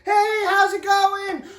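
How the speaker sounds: high, drawn-out friendly hello; happy cheerful greeting. Retold or imitated. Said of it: "you know, happy, cheerful".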